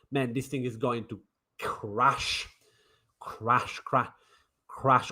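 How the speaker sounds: quiet doom-laden repetition; ominous hush. Real, not acted. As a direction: let the crashes sink away ominously. Ominous prediction of collapse.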